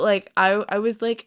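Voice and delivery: ordinary delivery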